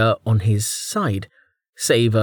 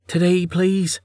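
higher-pitched, weary request; tired; 8 semitones higher than usual. A narrator's reading. Vanna wants the goat sent through, tired. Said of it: "sounding tired".

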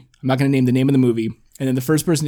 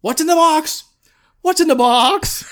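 high, loud, drawn-out wail; mock desperate movie-star cry; Brad Pitt; 6 dB louder than usual. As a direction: beg in hammed-up anguish. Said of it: "Brad Pitt's just like".

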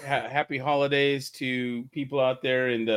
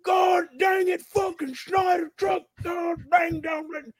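angry shout; 12 semitones higher, slower than usual. Retold or imitated. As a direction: scream it like an enraged trucker. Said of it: "someone's like".

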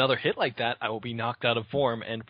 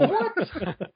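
squealing laugh, high and strained; high shrieking laugh. Real, not acted. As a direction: burst into a high, helpless laugh. Laughing at taking big damage.

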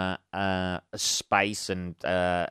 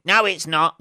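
higher, louder imagined heckler; mock objection; 7 semitones higher, 6 dB louder than usual. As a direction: cut in as an indignant objector.

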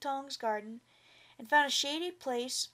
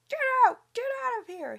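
very high, loud furious scream; 12 semitones higher, 8 dB louder, faster than usual. A narrator's reading. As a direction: scream him out of the garden. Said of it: "he screamed".

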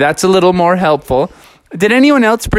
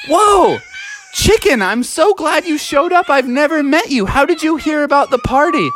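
excited greeting; 8 semitones higher than usual. Pretend. Guests greet chicken excitedly.

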